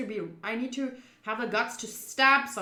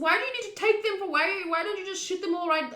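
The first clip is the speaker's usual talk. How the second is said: alarmed disbelief; 9 semitones higher, 5 dB louder than usual. Real, not acted. Alarmed reaction to threat.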